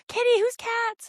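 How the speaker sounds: put-on very high little-kid squeal; piping, eager kid voice; curious kids. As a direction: imitate a child spotting a kitty. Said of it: "the other kids come over".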